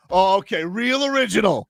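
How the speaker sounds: high-pitched sarcastic comeback; mock indignant sarcasm. Kids' show host to a Tinder date, mock sarcastic. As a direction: act out a put-upon, sarcastic retort. Acted retort to date's jab.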